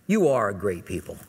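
low, gentle affirmation; compassionate dad. Retold or imitated. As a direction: reassure them like a kindly father. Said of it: "with a little bit more compassion".

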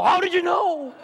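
high, amazed gasp of a question; hammed-up astonishment; mock amazement. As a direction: play the stunned mark. Mock astonished audience member.

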